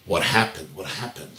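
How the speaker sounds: soft, low questioning; gentle concern. Retold or imitated. Wife probing her simmering husband, gentle concern. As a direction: ask it quietly and carefully.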